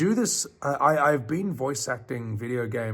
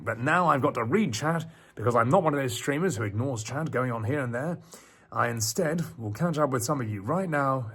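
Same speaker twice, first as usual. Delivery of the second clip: ordinary delivery, nothing marked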